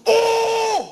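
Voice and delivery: high loud put-on "Åh!" cry; mock moan.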